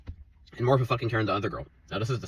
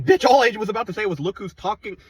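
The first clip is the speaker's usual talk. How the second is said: higher and louder, exasperated; retelling his outburst at the coworker, higher and louder; 11 semitones higher, 6 dB louder than usual. Retold or imitated. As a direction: throw it out in disbelief. Exasperated retold retort.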